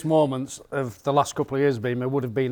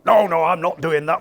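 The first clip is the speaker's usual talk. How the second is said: refusing; 4 semitones higher, 5 dB louder than usual. Retold or imitated. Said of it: "Coltart's the only one".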